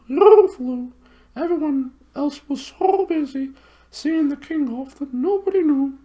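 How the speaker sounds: very high hooting owl voice, far from the narrator's own; high, hooting bird voice. Acted. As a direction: hoot it like a big owl. Owl voice held.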